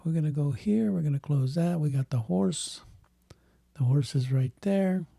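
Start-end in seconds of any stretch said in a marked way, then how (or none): none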